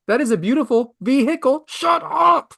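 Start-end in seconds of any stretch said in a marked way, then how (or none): 0.4-1.6 sarcastic, pitched-up "vehicle" with the H sounded; overemphasized H. Retold or imitated. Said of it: "You don't need that emphasis". 1.7-2.5 high, exasperated outburst; comic fed-up "enough of that" cry. Acted. Exasperated at the mocked pronunciation.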